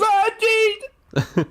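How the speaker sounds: high, startled yelp of fright; terrified cry. Retold or imitated. Jerry seeing his own feet in the shower, high terrified cry. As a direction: shriek as the memory hits.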